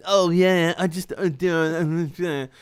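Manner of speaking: halting attempt at an English accent; mimicked English accent. Shown as a demonstration. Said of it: "I haven't done it in years".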